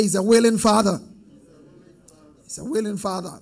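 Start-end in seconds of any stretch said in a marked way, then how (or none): 0.0-1.0 louder repeat of the line. Real, not acted. Preacher pushing the church to say it louder. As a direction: call it out to the congregation.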